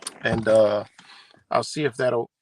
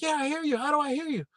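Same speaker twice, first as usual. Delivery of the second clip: much higher, puzzled woman's voice; Kimmy answering; 3 dB quieter, faster than usual. Pretend. Kimmy hears him from far off, high and puzzled. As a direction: answer amazed, pitched way up. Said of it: "She'd be like".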